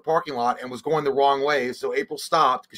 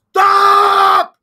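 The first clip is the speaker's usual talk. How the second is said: very high, piercing shout; desperate scream; 13 dB louder than usual. Retold or imitated. Retold outburst at wife.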